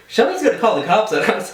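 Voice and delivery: fast, high, laughing alarm; worried laugh about the cops; laughing at the red smoke over downtown; laughing worry. Retold or imitated. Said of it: "I was like".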